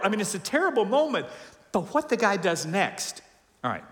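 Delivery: ordinary talk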